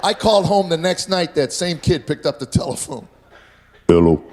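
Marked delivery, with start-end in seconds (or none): 3.9-4.2 "Hello." said much lower and louder; son's voice suddenly broken deep. Retold or imitated. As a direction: drop into a deep man's voice.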